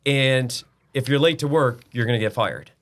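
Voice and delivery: father giving his son an ultimatum, loud and firm; stern loud ultimatum. Retold or imitated. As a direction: tell your son he's out, no arguing. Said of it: "I was like".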